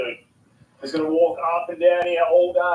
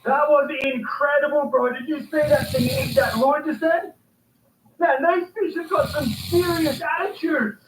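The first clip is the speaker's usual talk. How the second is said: excited; 7 semitones higher than usual. Real, not acted. Fish hooked and landed.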